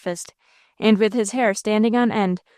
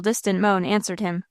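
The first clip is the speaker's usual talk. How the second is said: ordinary delivery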